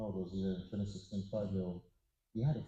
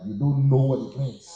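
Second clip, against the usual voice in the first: put-on loud, higher exasperated shout at the kids; mock parent outburst; 4 semitones higher, 14 dB louder than usual. Parent scolding a child who never struggled, a loud exasperated yell. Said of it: "you're like".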